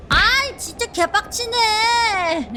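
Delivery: frustrated outburst. Real, not acted. Frustrated after dying.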